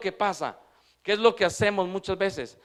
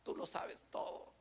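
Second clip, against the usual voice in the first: soft, slow, tearful whisper; tearful Peter; 2 semitones higher, 19 dB quieter than usual. Retold or imitated. Peter answering Jesus in tears, whispered. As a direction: breathe it out like a man on the verge of tears. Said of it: "Pedro empieza a llorar".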